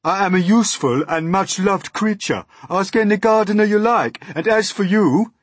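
high, loud, affronted earthworm; indignant earthworm. Acted. Earthworm defending its usefulness, indignant.

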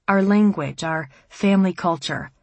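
usual speaking voice

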